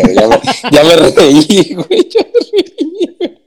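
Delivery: high, cracking with laughter; can't stop laughing mid-line. Real, not acted. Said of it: "no pude hacerlo serio".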